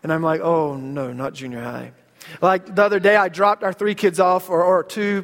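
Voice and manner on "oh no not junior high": low, softly deflating groan of words; mock dread. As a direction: sink quietly into mild dread. Dreading junior high, acted inner reaction.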